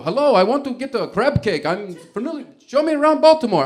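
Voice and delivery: high, loud Italian tourist voice; hammed-up Italian tourist demanding a tour; bad Italian accent. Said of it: "I can't do an Italian accent".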